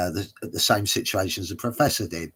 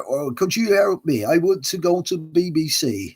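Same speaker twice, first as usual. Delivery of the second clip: higher, louder put-on Somerset voice; attempted Somerset accent; 7 semitones higher, 5 dB louder than usual. Actor in character asking a driver for help, mock Somerset accent. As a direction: imitate a simple Somerset passenger. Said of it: "Somerset accent, I think it was".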